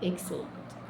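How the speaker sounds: breathy whispered excellent; whispered praise. Real, not acted. Teacher praises pupils in a whisper. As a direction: whisper it like a secret compliment.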